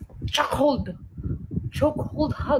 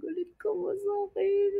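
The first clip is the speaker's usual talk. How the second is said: timid high-pitched plea; meek pleading question; small high question; 10 semitones higher, 5 dB quieter than usual. Retold or imitated. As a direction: ask it much higher and softer. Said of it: "sabi ko".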